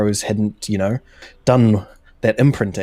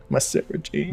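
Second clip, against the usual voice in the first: higher, softer put-on Doctor voice; mock Doctor impression; 5 semitones higher, 6 dB quieter than usual. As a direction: playfully mimic a doting Doctor. Mimics the Doctor with twirling scarf.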